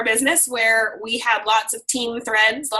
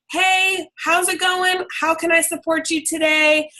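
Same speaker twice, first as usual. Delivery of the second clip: cheery check-in; 6 semitones higher than usual. Acted. Coach checking in with every new promoter, cheery and high. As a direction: call out a friendly hello to a teammate. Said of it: "and say".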